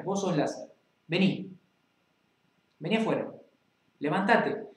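ordinary talk, nothing marked

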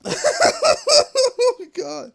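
laughing through the words; can't stop laughing; real laughter. Real, not acted. Gamer laughing at the game, laughing.

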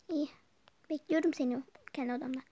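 ordinary talk

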